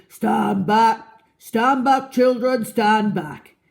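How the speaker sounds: loud booming warning call; big shout. A narrator's reading. Trunky warns the children away, shouting. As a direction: bellow it from the trees. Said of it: "big, deep voice from the jungle shouted".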